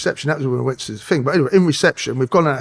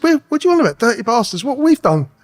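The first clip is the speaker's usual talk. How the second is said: raised, high-pitched protest; retold outburst, pitched up and louder; indignant comeback; 10 semitones higher, 5 dB louder than usual. Suspect throws the officers' words back, indignant.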